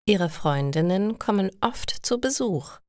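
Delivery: normal talking voice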